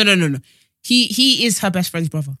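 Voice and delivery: ordinary delivery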